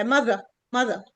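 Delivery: mother with the th and the tongue out; mother with a th sound. Shown as a demonstration. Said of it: "لسانك طالع عشان ال تي اتش".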